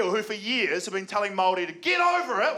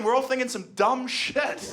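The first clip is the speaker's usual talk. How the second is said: ordinary delivery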